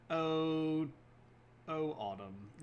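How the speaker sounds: ordinary delivery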